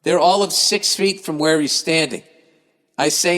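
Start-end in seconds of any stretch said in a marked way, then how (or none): none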